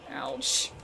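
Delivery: usual speaking voice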